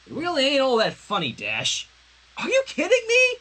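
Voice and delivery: laughing disbelief at the end; voiced exchange. Sunset to Dash, Dash howling in disbelief.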